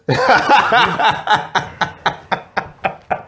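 long high-pitched laughing fit; cracking up. Real, not acted. Friend delighted by the meme page, high cackling.

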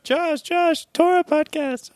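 high, eager fan voice; mock eager fan. Imitating fans clamoring.

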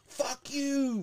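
very high, drawn-out mock yell; hammed-up high holler; mock screaming. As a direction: reenact screaming out of a truck. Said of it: "screaming at him".

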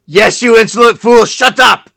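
angry minion yell. Acted. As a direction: yell at the fool. Minion yells at Doctor.

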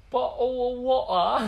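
high, loud mock British, dropped t's; British "bo'oh o' wa'er", drawn out; British glottal stops. Quoting a viral tweet reader, loud mock British accent. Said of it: "laughing hysterically".

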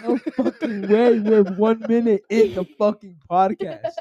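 high, drawn-out incredulous protest; disbelief. Real, not acted. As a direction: laugh in amazed disbelief, stretching it out. Reacts in disbelief to time.

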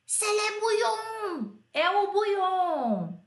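put-on high, slow shouted quote of a kid; kid shouting. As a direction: yell it out like a startled schoolboy. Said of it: "Teve um lá que gritou". (grito agudo e lento de criança)

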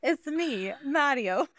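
high, bouncy game-hero catchphrase; high-pitched catchphrase impression; Mario. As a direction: play the cartoon Italian plumber introducing himself. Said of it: "the Super Mario movie".

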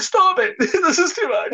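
high, hammed-up pleading; mock tearful plea. Fan begging actor to stop, mock tearful plea. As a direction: plead as if overwhelmed by a tearjerker. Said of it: "make me wanna cry".

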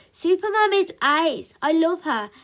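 high, loud, adoring declaration; devoted fan defending his hero; enthusiastic little boy. A narrator's reading. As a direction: gush proudly and loudly. Boy defends Super Mum to sister.

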